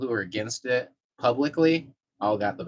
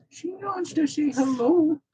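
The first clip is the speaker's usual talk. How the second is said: much higher, slow squeaky cat voice; cat's voice. Acted. Owner voicing the cat greeting viewers, high and slow. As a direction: speak for the cat in a tiny high voice.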